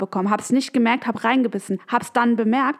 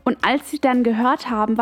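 ordinary delivery

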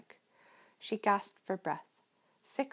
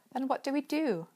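higher, barely hidden panic ending on a squeak; frantic, held back; 6 semitones higher than usual. A narrator's reading. Meg hiding her panic from her possessed brother. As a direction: try to sound calm and fail. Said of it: "tried to keep the franticness out of her voice".

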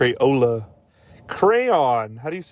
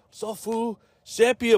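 mock gangster; 8 semitones higher, slower than usual. Gangster naming gang.

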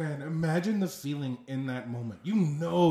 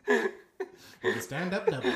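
higher announcer's call, laughing; baseball voice; 4 semitones higher than usual. Acted. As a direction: finish the call as a commentator. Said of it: "think of the baseball voice".

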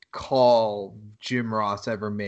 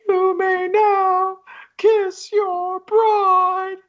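very high, very slow drawn-out voice; mock ominous vows; 7 dB louder, much slower than usual. S0 retelling the Dark Wedding vows, hammed-up slow officiant voice. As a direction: intone it like a creepy wedding officiant. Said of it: "he gets to the".

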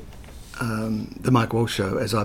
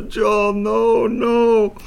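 put-on German accent; 11 semitones higher, 5 dB louder, much slower than usual. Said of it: "I'm not doing the right accent".

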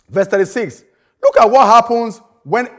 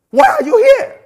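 Why? flung up high and louder; pointed challenge; 14 semitones higher, 3 dB louder than usual. Real, not acted. Preacher challenging the congregation, high and insistent. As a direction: jab the question at them.

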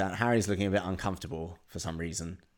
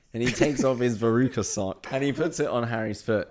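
usual speaking voice

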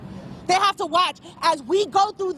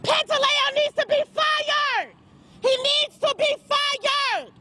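higher, slow, hammering demand; angry demand; 7 semitones higher, slower than usual. Real, not acted. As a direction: cry it out to the crowd. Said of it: "I'm gonna scream it".

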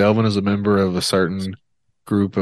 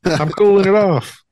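put-on high, louder child voice; little kid voice; 11 semitones higher, 4 dB louder than usual. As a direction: play a whiny little kid. Child voice continues.

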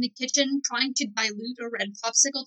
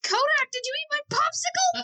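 much higher, squealed accusation; high-pitched accusing yell; 14 semitones higher than usual. Acted. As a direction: yell it across the house in a high voice. Acted popsicle quarrel, yelling through house.

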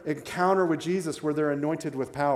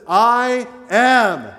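booming declaration; 7 semitones higher, 11 dB louder than usual. Acted. Jesus declares his name to the soldiers, booming. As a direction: ring out I am, much higher and much louder.

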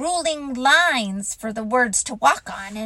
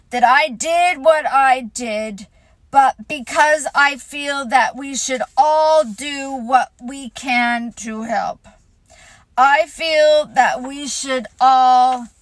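pompous speech; 3 semitones higher, 7 dB louder than usual. Acted. Eeyore resumes speech.

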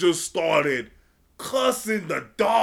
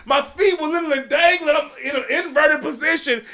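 normal talking voice

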